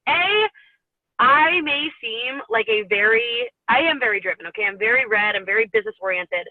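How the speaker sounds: ordinary talk, nothing marked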